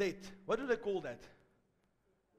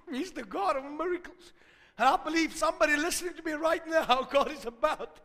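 loud, high full-force preaching; impassioned sermon climax; 7 semitones higher, 7 dB louder than usual. Real, not acted. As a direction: build to a shouted exhortation to hold on.

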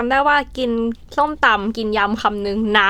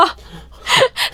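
laugh; 13 semitones higher than usual. Real, not acted. Overeating story, bursts into laughter.

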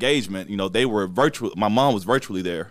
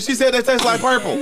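high sing-song laughing; 13 semitones higher than usual. Real, not acted. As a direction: laugh the line out.

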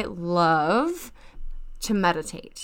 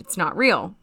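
normal talking voice

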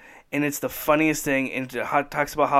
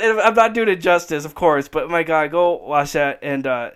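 usual speaking voice

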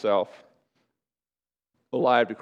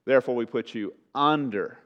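ordinary talk

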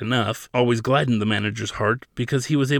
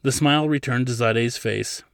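usual speaking voice

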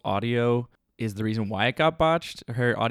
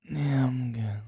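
stoned voice; slower than usual. Acted. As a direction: play it blazed and sluggish. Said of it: "That's how I sound when I'm high".

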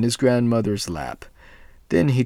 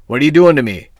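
loud, higher furious cry; angry devil; 6 semitones higher, 12 dB louder, much faster than usual. A narrator's reading. The devil yells at his grandmother, loud and high. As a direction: bellow at the grandmother in pain. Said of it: "screamed the devil".

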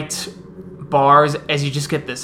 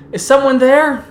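higher, louder call into the dark; 11 semitones higher, 8 dB louder than usual. Acted. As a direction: call out to whoever might be there. Guard calling into the dark.

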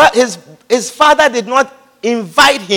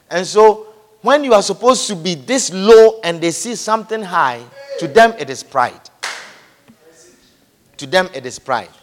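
ordinary talk, nothing marked

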